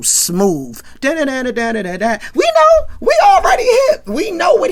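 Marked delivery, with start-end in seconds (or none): 2.3-4.7 high, loud, laughing outburst; excited laughing shout. Real, not acted. Hyped reaction to [name].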